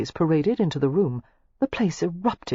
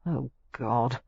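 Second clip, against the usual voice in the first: muttered despair; 4 semitones lower, 5 dB quieter than usual. A narrator's reading. Matthew's inner groan.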